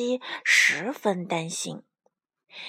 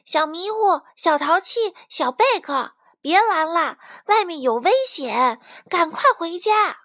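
high, raised warning call; urgent calling out; 7 semitones higher, 6 dB louder than usual. Acted. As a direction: summon playmates home at once. Calling playmates home from danger. (高声催促地呼唤)

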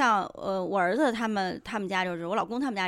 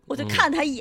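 ordinary talk, nothing marked

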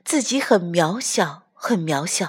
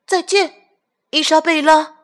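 high farewell; 9 semitones higher, 5 dB louder than usual. Acted. Farewell to stork.

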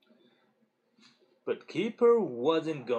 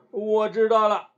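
loud, higher burst of glee; loud gleeful; 7 semitones higher, 9 dB louder than usual. A narrator's reading. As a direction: shout out the idea with delight. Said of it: "Keeper就大声，大笑起来".

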